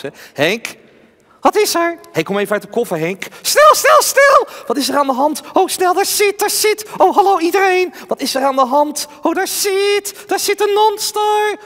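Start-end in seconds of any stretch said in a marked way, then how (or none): 1.4-2.0 high puppet voice; squeaky ventriloquist doll voice; talking doll. Acted. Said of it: "Henk is mijn pratende pop". 3.4-4.5 high panicked puppet shout; panicked doll shout. Acted. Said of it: "Henk is mijn pratende pop". 4.7-11.6 high, scared puppet voice; nervous ventriloquist doll; talking doll. Acted. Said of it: "Henk is mijn pratende pop".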